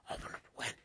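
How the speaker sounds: hushed, hurried whisper; urgent whisper. Retold or imitated. Kids hiding smoke from mom, urgent whisper. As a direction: whisper it quickly, as if hiding something.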